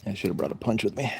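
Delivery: usual speaking voice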